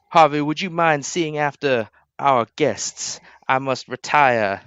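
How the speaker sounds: slow, formal lordly voice; courteous lord. Acted. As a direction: take your time, excusing yourself with ceremony. William excuses himself to retire.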